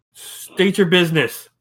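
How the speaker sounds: a hint of an officious sentry; lightly acted guard challenge. Guard challenges the arriving party.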